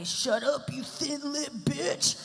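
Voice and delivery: slow, rising mock insult; hammed-up heckling troll; internet troll. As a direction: jeer it in a put-on troll voice. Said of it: "those trolls that say".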